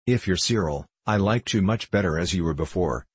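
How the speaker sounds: ordinary delivery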